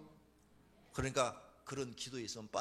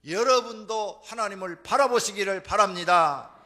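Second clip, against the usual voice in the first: loud, raised preaching voice; full-force preaching; 8 semitones higher, 13 dB louder than usual. Real, not acted. Preacher urging the congregation to look to God, forceful preaching. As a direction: press each phrase home loudly to the congregation.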